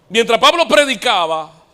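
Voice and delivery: voz de predicador alta y aguda; prédica enérgica. Real, not acted. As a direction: proclama con fuerza, subiendo el tono. (predicación a plena voz)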